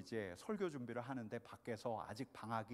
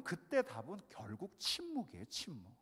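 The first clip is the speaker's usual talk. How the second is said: ordinary delivery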